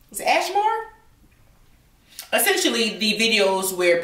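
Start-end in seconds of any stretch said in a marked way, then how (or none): 0.1-0.9 squeaky confused question; high questioning. Real, not acted. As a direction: ask it up high, puzzled. Mock confusion over the word.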